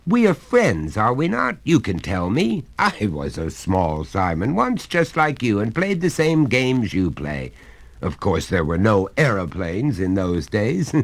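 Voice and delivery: a hearty father coaxing his son; genial father. Acted.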